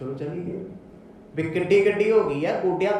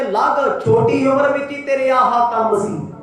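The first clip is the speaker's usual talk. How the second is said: angry father; 6 semitones higher, 7 dB louder than usual. Acted. Father rebuking his son before the villagers in the field. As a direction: berate him in front of everyone. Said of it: "ਪਤਾ ਕੀ ਬੋਲਦੇ".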